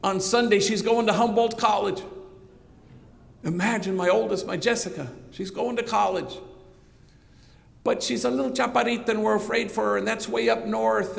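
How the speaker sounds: urgent, high, tumbling out; an anxious father pleading fast; breathless and panicky. Retold or imitated. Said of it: "kind of breathless and panicky".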